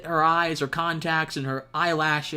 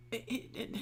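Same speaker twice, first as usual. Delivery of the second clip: usual speaking voice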